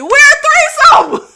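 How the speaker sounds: loud, high, laughing shout; real laughing shout about her dogs; laughing proclamation. Real, not acted. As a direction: announce it like a punchline. Joking about her two dogs.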